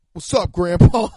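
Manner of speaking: very loud, hammed-up grandkid; mock grandkid greeting. Adopted kid greeting dying grandpa, loud comic shout. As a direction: blurt it loudly as a pretend adopted kid. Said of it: "so they'll be like".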